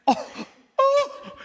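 high-pitched mock sobs; imitated crying. Preacher mimics a tough groom seeing his bride, mock crying. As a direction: squeal out a groom's sudden weeping, hammed-up. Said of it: "he starts crying".

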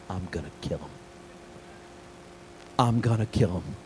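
low, hushed mock threat; murderous inner thought. Youth pastor privately fuming at the kid, retold in a low hush. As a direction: say it under your breath, as a private thought. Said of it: "I thought in my mind".

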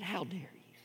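a hissed whisper, confronting; angry whisper. Retold or imitated. Paul in Peter's face, whispered rebuke. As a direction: whisper it right in his face.

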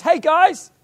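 high, loud shout; calling out from shore. Retold or imitated. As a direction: hail a far-off boat crew. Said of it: "he shouted out to them and says".

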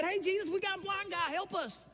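high, goofy crowd voice hollering; crowd calling out. Retold or imitated. Crowd calls out to Jesus, high hammed-up holler. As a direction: ham up the crowd shouting for help.

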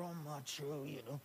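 soft, hesitant mock mumble; mock unsure man. Preacher mimicking a doubtful man in church, soft hesitant mock. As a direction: play an uneasy guy in the pews. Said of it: "creep some of the guys out".

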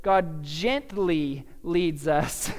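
gently leads said slow and drawn out; each word of God gently leads us stretched out slowly; slow, emphasized. Shown as a demonstration. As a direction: lean on every word, slowly. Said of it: "I had, uh, emphasized in my notes, I didn't do it".

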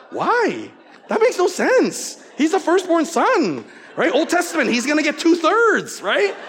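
high, loud mock outrage; indignant protest. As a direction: protest loudly at the unfairness, pitch way up. Acting out his indignant protest.

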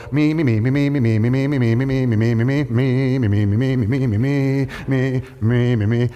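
lightly voiced, chanting me over and over; a touch of mocking self-obsession; mock self-absorbed friend. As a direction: babble me me me like a self-centered friend. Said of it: "they go".

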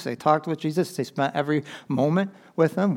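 ordinary delivery

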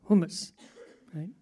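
humus with a throaty Arabic h, HOO-mus; Arabic accent. Shown as a demonstration. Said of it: "I'll use my Arabic accent".

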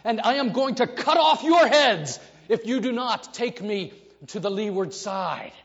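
captain shouting threats with a laugh; furious captain. Retold or imitated. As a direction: bark orders as an enraged captain. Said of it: "And then I say".

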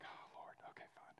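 whispered, giving in with a sigh; a defeated little whisper to yourself; resigned whisper. Retold or imitated. Husband whispering to his wife as they give in, resigned whisper.

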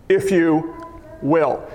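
"will" set apart, falling low; stress on "will". Shown as a demonstration. Preacher correcting a prayer, firm low "will". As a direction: pause before a low, settled "will". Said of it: "say will".

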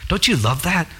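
ordinary delivery

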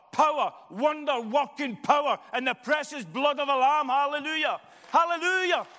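loud, high-pitched rousing sermon; full-force preaching. Real, not acted. Preacher urging the congregation to welcome people back, full-force preaching.

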